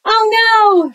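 high, loud cry of alarm; a bear shouting in sudden alarm; shouted. A narrator's reading. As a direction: yell it out in a panic. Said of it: "shouted Bear".

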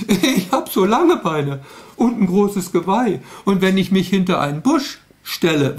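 a gangly moose complaining. Acted. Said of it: "Da sagt der Elch".

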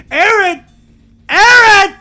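loud, high, near-crying call; real hurt yell; reproachful calling. Real, not acted. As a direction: call out Aaron for not showing up. Reproaching absent partner Aaron.

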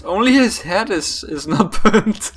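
amused, talking through a laugh. Real, not acted. Player laughing at poor burnt creatures, laughing.

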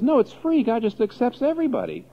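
high, airy mock relativist; breezy relativist. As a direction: toss it off lightly, like nothing matters. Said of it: "the relativist says".

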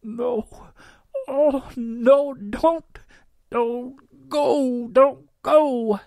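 high, loud, breaking desperate plea. A narrator's reading. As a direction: cry out as the voice breaks. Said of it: "stammers and breaks".

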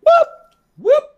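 squeaky high whoop. Real, not acted. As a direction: pitch the whoop way up. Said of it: "I can't go that high".

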